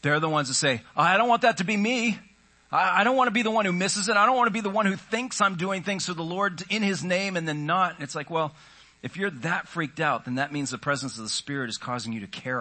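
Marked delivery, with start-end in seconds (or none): 1.0-8.0 higher, louder, rushed worried plea; fretful, pressured believer's voice; anxious pleading. Acted. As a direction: blurt it out like someone scared of missing God.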